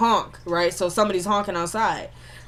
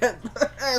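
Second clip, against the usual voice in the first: burst of laughter; 4 semitones higher, 6 dB quieter than usual. Real, not acted. Laughs at the scene.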